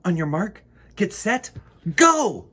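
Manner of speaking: put-on a girl starts her race car for the baby; excited countdown. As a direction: build up and launch on go.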